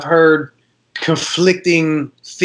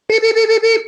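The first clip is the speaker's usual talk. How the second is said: high electronic beeping; tester beeps; faster than usual. Retold or imitated. Retelling the diamond tester going off, high beeps. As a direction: mimic a machine beeping, way up high.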